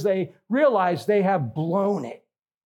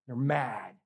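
normal talking voice

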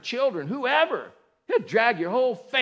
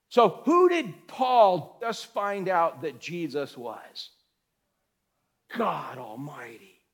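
ordinary delivery

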